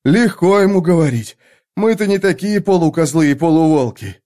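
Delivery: breathless, loud and winded; panting shaky eyewitness. Acted. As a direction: gasp it out mid-run. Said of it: "задыхаясь".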